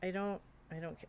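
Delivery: usual speaking voice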